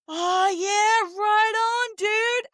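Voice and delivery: high, laid-back mock Canadian drawl; slow Canadian dude. Mocking how locals answered her, high lazy dude voice. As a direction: lazily stretch it out like a chill local. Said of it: "And it sounded so slow".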